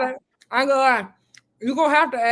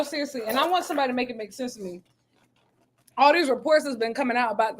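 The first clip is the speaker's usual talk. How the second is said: ordinary talk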